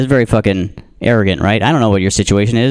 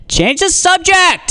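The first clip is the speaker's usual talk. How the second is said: much higher, slow frantic cry; mock frantic heckle; frantic lady; slower than usual. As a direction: plead in panic from the back. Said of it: "She's, like, real frantic".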